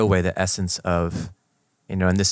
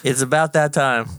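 put-on country accent; 6 semitones higher, 4 dB louder than usual. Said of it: "in country accents".

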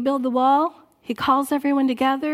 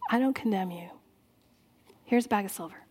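gentle forgiveness; 5 semitones lower, 9 dB quieter, faster than usual. Retold or imitated. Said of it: "he's gonna say".

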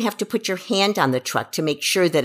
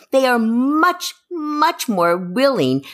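much drawn out, slow and high; much held long and high; stress on much; 6 semitones higher, slower than usual. Real, not acted. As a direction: stretch the first much to emphasise. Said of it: "emphatic repetition".

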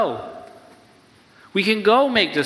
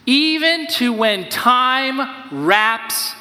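emphatic paraphrase; 5 semitones higher, much slower than usual. Acted.

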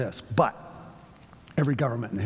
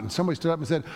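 ordinary talk, nothing marked

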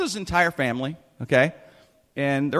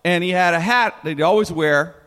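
usual speaking voice

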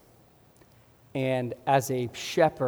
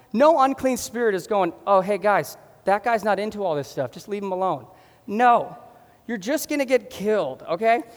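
high, loud, impassioned sermon; fired-up preaching; 5 semitones higher, 4 dB louder than usual. Real, not acted. Pastor preaching to the church, passionate and raised. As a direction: preach it hard, with fire. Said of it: "Sorry, I got fired up".

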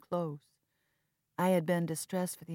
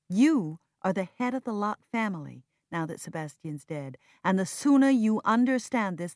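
loud, higher, insistent lecturing; heated scolding; 5 semitones higher, 9 dB louder than usual. Acted. Sister scolding brother about duty, loud and firm. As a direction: lay down the law to him.

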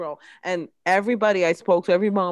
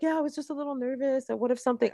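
sheepish nervous reply; 7 semitones higher, 6 dB quieter, faster than usual. Retold or imitated. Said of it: "And I was like".

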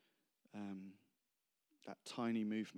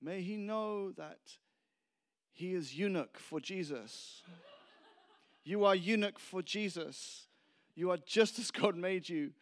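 bad Argentine accent; 7 semitones higher, 4 dB louder than usual. Preacher imitates foreign man praying over him, bad Argentine accent. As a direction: pray earnestly in a hammed-up Argentine accent. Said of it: "it's sort of Argentine accent".

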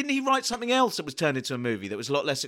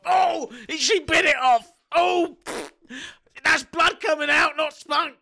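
mock shouting; 10 semitones higher, 4 dB louder, slower than usual. Acted mock scene, shouting.

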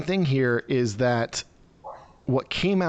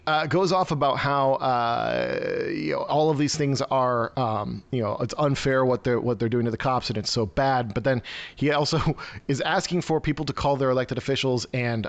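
ordinary delivery

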